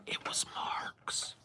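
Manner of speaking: a breathy whisper, almost no voice; whispered answer. Real, not acted. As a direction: whisper it as a quick aside. Answering a chat question, whispered aside.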